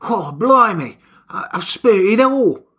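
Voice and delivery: lightly voiced Cockney husband; Cockney, flustered excuse; a hint of a sheepish Cockney. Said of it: "he was like".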